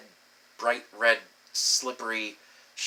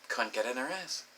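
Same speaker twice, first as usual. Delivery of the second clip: ordinary talk, nothing marked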